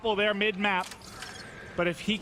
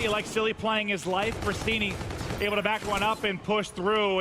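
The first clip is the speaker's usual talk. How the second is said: ordinary talk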